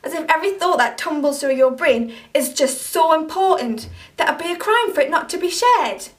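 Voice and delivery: angry confrontation. Acted. To Mark, for calling her a bitch online: angry and accusing. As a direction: tear into him for what he posted.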